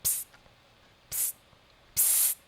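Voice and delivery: whispered attention-getter; furtive, voiceless call; secretive psst. Acted. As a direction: hiss for attention on the sly. Wolf secretly calls the witch.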